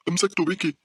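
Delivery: very high, excited call; urgent cry. A narrator's reading. Girl grabs her friend's wrist, urgent high cry. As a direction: cry out as the grab lands. Grabbing friend at closing doors.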